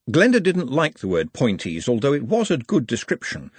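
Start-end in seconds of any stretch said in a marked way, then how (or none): none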